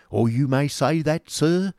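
high, pompous schoolmaster voice; Squeers the schoolmaster. A narrator's reading. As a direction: play Squeers as a bluff, self-satisfied pedagogue.

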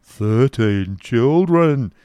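very slow, drawn-out cry; shouting genius. A narrator's reading. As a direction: call it out, stretching every word. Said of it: "shouted the genius".

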